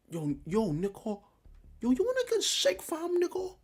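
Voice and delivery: put-on over-the-top British accent parody; hammed-up British accent. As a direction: squeal it like a caricatured British youth. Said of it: "in the British accent".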